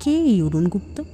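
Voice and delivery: plain reading voice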